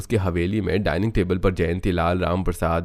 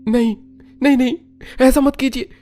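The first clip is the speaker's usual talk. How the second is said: high, loud desperate pleas; frantic screamed begging; screaming in panic; 7 dB louder than usual. Acted. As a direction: cry out to stop it, as in a nightmare. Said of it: "संभव चीखते उठ जाता है".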